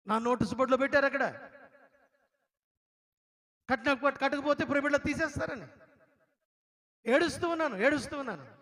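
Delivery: loud, high preaching voice, pressing the story home; preaching at full force. Acted. Preacher telling the congregation of his tears, in a loud, high voice. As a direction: deliver it like a fiery preacher.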